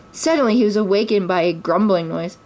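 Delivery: plain reading voice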